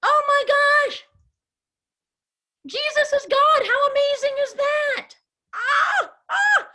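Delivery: high, loud awestruck cry; hammed-up wonder for the children; total amazement. Shown as a demonstration. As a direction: shout it out like the news just blew your mind. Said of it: "so they're in this total amazed place".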